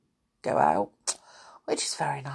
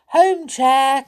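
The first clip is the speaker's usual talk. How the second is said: higher, louder command; cried out; 8 semitones higher, 7 dB louder than usual. Acted. Peter orders the magic chair home, loud cry. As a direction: call it out to the chair. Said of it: "cried Peter".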